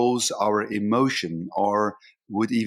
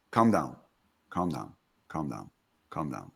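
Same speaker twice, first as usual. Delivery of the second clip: calm down fading lower and softer to almost nothing; soothing self-calming; 8 semitones lower, 6 dB quieter than usual. Retold or imitated. Referee calming himself while a player shouts, fading soft and low. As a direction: talk yourself down, dropping lower and softer. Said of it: "And I will say".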